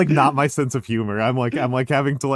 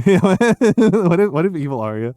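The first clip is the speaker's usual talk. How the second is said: laughing; 8 semitones higher, 6 dB louder, slower than usual. Real, not acted. Laughing at the idea.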